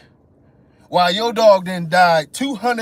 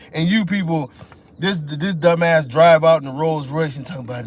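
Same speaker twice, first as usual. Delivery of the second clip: ordinary talk, nothing marked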